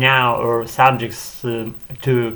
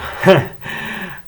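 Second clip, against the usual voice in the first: short unvoiced chuckle at himself; breathy laugh; 7 semitones higher than usual. Real, not acted. Speaker amused by his own word choice, laughing.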